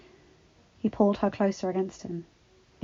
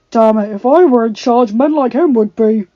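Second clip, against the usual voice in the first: furious; 3 semitones higher, 18 dB louder than usual. A narrator's reading. Adam enraged.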